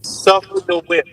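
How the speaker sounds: normal talking voice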